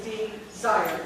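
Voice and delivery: DESIRE slow and loud as de-ZIRE; two separate beats in "desire", de, zire; two syllables. Shown as a demonstration. Teacher showing it is two syllables, slow pronunciation. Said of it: "It's two syllables".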